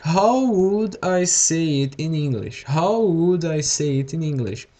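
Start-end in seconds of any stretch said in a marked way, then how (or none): none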